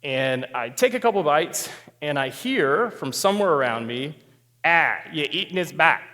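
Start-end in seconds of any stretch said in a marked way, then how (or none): 4.6-6.0 put-on thick Boston accent, dropped r, muttered from nearby. Stranger at next table to the diner, in Boston accent. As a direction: imitate a Boston local's flat vowels. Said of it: "people in Boston speak differently".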